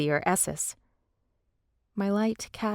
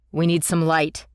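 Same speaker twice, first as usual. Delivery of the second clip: demanding; 2 semitones lower, 5 dB louder than usual. A narrator's reading. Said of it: "Liam demanded".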